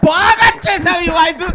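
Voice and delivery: high, drawn-out comic shout; over-the-top loud approval; shouted praise. Acted. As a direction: bellow the compliment slowly. Senior mimic over-praises the rookie.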